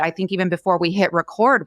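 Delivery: normal talking voice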